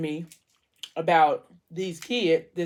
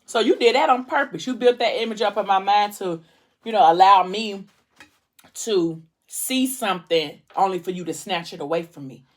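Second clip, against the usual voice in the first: enraged retelling; 3 semitones higher, 5 dB louder than usual. Retold or imitated. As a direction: spit out the betrayal in angry disbelief. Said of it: "it kinda turned that into rage".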